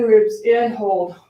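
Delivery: ordinary talk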